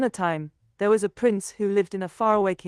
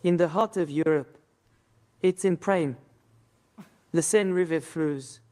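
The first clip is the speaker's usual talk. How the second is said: French accent; 3 semitones lower than usual. Shown as a demonstration. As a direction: recite with a French accent, pausing between lines. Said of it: "Speak with a French accent".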